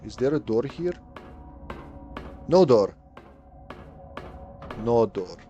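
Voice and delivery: ordinary talk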